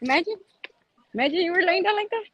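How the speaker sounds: high, fast, excited imagining; rising excitement, picturing the tumble. Real, not acted. Picturing a fall downhill.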